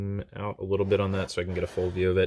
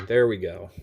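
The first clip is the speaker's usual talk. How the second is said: normal talking voice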